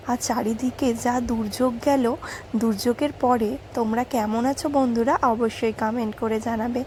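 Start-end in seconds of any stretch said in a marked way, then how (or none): none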